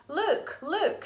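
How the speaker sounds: put-on high excited calls of children; excited shout. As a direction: call out pointing at the picture. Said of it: "shouted her friends".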